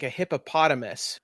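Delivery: usual speaking voice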